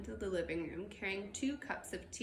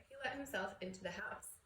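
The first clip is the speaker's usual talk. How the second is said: ordinary delivery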